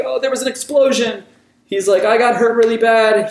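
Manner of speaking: high, whiny playing-hurt voice; fake victim act. Recapping Kira's trick, a high whiny fake victim. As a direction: pretend to be the injured bystander. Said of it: "that's pretty much what, uh, Kira does here".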